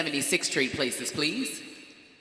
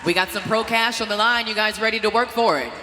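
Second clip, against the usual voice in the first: loud, high stage emcee call; booming pumped announcer; 5 semitones higher, 4 dB louder than usual. Acted. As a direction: pump up the crowd and athletes. Emcee hyping bodybuilding stage.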